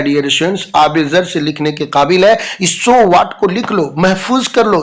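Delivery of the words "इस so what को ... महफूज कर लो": ordinary delivery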